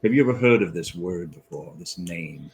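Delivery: ordinary talk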